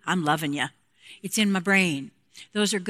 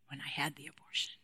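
a near-whisper, quick and breathless; hushed confession; 5 semitones lower, 13 dB quieter, faster than usual. Real, not acted. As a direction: let the words slip out as a secret. Confessing a painful memory.

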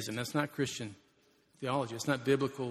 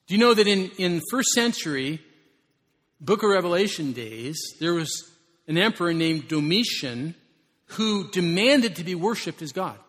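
louder, urgent sermon delivery; fervent storytelling preaching; 4 semitones higher, 9 dB louder than usual. Real, not acted. Pastor telling of emperor Domitian, loud urgent preaching.

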